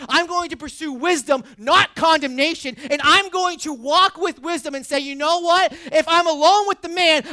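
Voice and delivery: loud, high, driving sermon voice; preaching at full force. Acted. Pastor urging congregation to pursue wisdom, full-force preaching. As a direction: preach it hard to the room.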